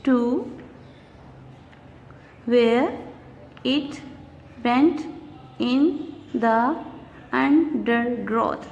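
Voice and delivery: slow, each syllable set apart. Shown as a demonstration. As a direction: read the line slowly, beat by beat. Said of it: "Let's see the fifth line".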